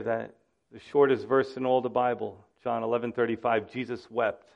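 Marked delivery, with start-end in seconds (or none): none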